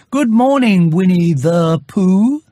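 put-on high, slow child's reply; boy's greeting. Christopher Robin greets Pooh back, high and slow. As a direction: answer like a small boy.

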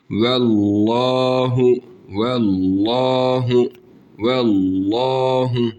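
Allahu with a full thick l, the a held one elif; thick recitation. Shown as a demonstration. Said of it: "bir elif miktarı uzatıyoruz". (Allahu kalın ve bir elif uzatarak)

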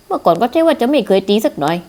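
normal talking voice

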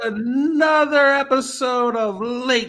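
high, slow, drawn-out announcer call; stretched-out late-night intro; show announcer. Acted.

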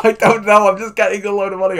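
high, loud and fast, laughing through it; bubbling over with glee; giddy laughing. Real, not acted. Streamer to chat, giddy over the pile of donations.

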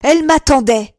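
shouted "Elle m'attendait", building; jealous shouting. Acted. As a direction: bellow it to anyone who'll listen. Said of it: "criait-il".

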